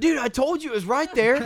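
high, loud imitated yell; retold scream. Friend yelling at soaked hunters, mock scream. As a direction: yell it like an exasperated I-told-you-so. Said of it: "And he screams at that time".

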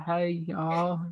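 slow, softly mimicked Southern American accent; put-on Southern greeting. Echoes the Southern accent attempt.

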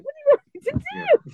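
very high, mock helpless wail; high exasperated wail. Recalling being locked in, a high exasperated wail. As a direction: whine it like a sobbing teen. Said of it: "I was like".

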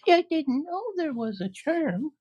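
put-on high girlish voice, caught off guard; young girl's wide-eyed surprise; surprised Dorothy. Dorothy learning of the charm, high surprised voice.

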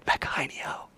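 soft unvoiced whisper. Shown as a demonstration. Lover whispering a secret code word, whispered. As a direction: breathe the code word in an ear. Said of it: "they say".

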